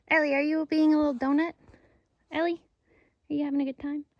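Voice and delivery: high, bright voice for the dog; pet talk. Real, not acted. Talking to her dog Ellie.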